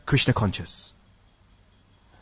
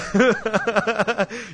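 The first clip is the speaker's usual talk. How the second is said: higher-pitched laugh; amused laugh; 6 semitones higher than usual. Real, not acted. Teacher amused by his own grammarian act, laughing.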